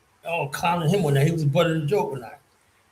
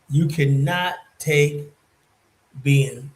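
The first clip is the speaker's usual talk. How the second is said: ordinary delivery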